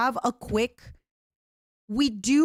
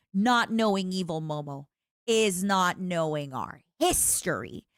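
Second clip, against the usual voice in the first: ordinary talk, nothing marked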